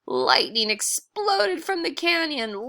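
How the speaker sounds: ordinary delivery, nothing marked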